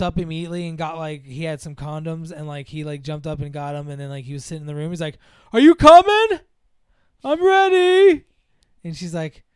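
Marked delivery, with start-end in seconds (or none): 5.5-8.2 very high, loud mock-excited holler; eager calling out. Boyfriend waiting with condoms calls girlfriend, eager hollering. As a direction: holler it across the house, hammed-up.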